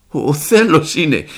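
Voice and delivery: loud, slow, stammered realization; trembling. A narrator's reading. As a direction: stammer it out slowly, shaken by the thought. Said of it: "είπα τρέμοντας".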